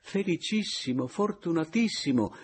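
exclaiming. A narrator's reading. Papiano greets Meis, effusive exclamation. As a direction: gush out the welcome. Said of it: "esclamò quegli".

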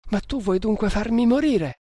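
high, distressed questioning; desperate plea. A narrator's reading. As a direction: reproach him in desperate fear. Terrified girl pleads with him to flee. (disperata, con voce acuta)

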